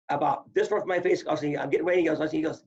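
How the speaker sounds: ordinary talk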